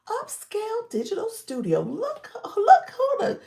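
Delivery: high, loud, laughing greeting, slowing down; laughing, excited welcome. Real, not acted. Host greeting an old friend joining the live, squealing and laughing. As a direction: squeal with delight as a friend arrives.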